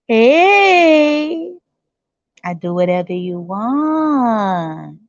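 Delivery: drawn-out, servile mock-feminine voice; fawning women. Women promising to serve men, slow fawning. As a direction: stretch the words like a devoted servant.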